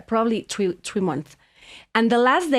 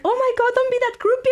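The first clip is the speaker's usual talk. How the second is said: mock scolding; 14 semitones higher, faster than usual. Acts husband scolding the fan.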